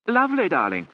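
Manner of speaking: high, loud and forceful; violent gush. A narrator's reading. Lady Charles forcing cheer at the tense children, loud. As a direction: fling it out over-brightly. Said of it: "said Lady Charles violently".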